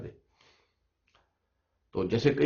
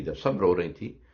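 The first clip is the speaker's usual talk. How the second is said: usual speaking voice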